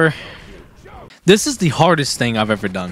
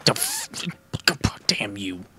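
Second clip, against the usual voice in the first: normal talking voice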